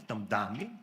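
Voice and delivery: ordinary talk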